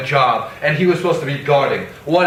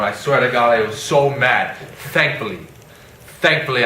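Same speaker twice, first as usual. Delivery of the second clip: usual speaking voice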